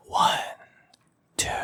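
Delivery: playful breathy whisper, drawn out; mock ASMR whisper. Teacher joking about earning money with ASMR, mock ASMR whisper. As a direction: whisper it slowly like an ASMR clip. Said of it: "에이에스엠알 하면은".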